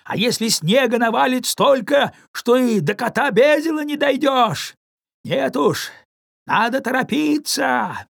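high, loud shout into a blizzard; shouting over wind. Acted. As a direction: yell against the storm. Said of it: "прокричал в ответ".

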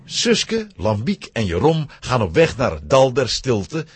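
ordinary talk, nothing marked

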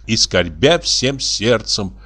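ordinary delivery, nothing marked

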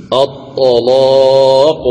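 الطلاق chanted very slowly with a thick, velarized L; heavy lam. Shown as a demonstration. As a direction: hold the heavy "laa" long in الطلاق. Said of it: "إذن تغلظ اللام".

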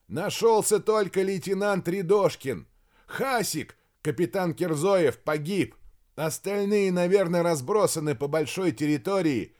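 high, insistent calling; shouted explanation. A narrator's reading. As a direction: argue back over a distance. Major shouts explanation to colonel. (настойчиво, на повышенных тонах)

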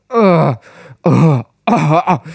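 short strangled gasps for breath; choking gasps. Acted. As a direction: gasp repeatedly, suffocating. Man drowning, gasping. (хриплые задыхающиеся вдохи)

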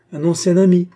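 high, loud exclamation; exclaiming. A narrator's reading. Queen correcting the humble soldier, exclaiming. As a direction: cry it out emphatically. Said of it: "s'écria la reine".

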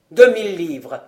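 loud cried-out offer; exclaiming. A narrator's reading. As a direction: burst out with it, loud on the first word. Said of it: "s'écria Vaunois". (en s'écriant, d'une voix forte)

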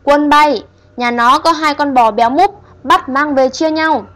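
hét lên the thét ma quái; ghost shouting. Acted. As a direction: bellow orders at the minions. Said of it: "bóng trắng lại hét lên".

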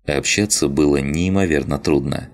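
plain reading voice

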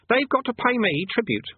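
put-on high-pitched boy voice; demanding boy. Boy Eric claiming his due, high demanding voice. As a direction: insist like a kid, pitched right up.

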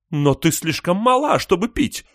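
high, loud outraged shout. A narrator's reading. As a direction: yell it at the grandchild in alarm. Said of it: "закричала Большая Барбара".

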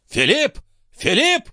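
very high calling voice; high-pitched summons from an old man; old man's cry. A narrator's reading. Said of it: "закричал старик".

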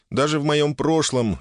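ordinary delivery, nothing marked

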